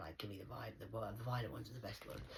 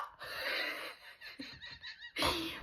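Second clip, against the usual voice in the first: high laugh. Real, not acted.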